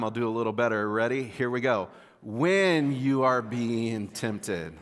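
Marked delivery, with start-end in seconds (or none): 2.2-4.7 very slow read-along with "When" drawn out and punched; stress on "When". Shown as a demonstration. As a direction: lead a group reading, holding "When" long. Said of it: "put the emphasis on the word when".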